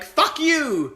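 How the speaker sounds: very high, loud "Fuck you!"; mock furious shout. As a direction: yell it like the friend is fed up. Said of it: "you're very loud".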